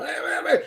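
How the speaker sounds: high hammed-up cackle; mock evil cackle. Streamer imitating a movie villain laugh, high cackle. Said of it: "who's I'm imitating".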